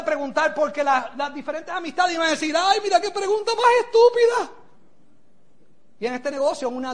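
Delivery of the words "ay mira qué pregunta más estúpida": high-pitched mock sneer; mocking classmate. School friends ridiculing a question, mocking imitation. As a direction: jeer it high, like scoffing schoolmates.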